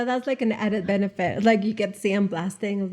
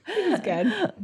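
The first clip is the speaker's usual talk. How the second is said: laughing; 5 semitones higher than usual. Real, not acted. Laughing about the sexy voice.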